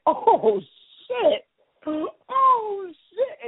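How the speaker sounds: high, drawn-out astonishment; shocked mock reaction. Bystander reacting to a cruel insult, mock shock. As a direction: react like a bystander to a brutal burn.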